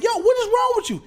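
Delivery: high, incredulous demand; exasperated father. Retold or imitated. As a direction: imitate a dad confronting his son in disbelief. Acting exasperated father on phone.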